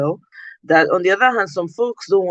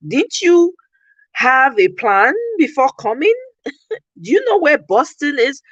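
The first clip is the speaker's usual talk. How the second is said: incredulous scolding; 7 semitones higher than usual. Retold or imitated. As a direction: scold him as if you can't believe it. Retelling scolding a stranded acquaintance.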